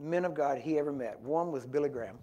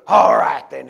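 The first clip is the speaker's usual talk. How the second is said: huge bellowed preacher impression; big old voice; 19 dB louder, slower than usual. Preacher answering the woman before grabbing her head, big old voice. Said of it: "he had this big old voice".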